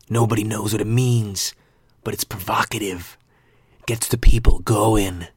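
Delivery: quoted movie line in a put-on voice; Will Ferrell. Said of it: "that quote from Will Ferrell".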